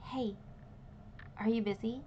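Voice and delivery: slightly softened voice in the ear; a quiet, tentative check-in; really quiet. Acted. Dragonborn friend calling Clarence over earrings, really quietly. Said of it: "in your ear really quietly".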